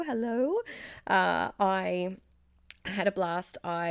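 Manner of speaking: ordinary talk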